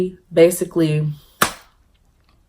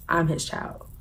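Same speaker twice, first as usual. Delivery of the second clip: soft, understated protest; quiet retort; 11 dB quieter than usual. Retold or imitated. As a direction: state it softly, held back. First quiet take of argument.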